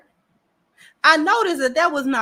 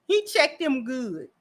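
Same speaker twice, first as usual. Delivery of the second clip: ordinary delivery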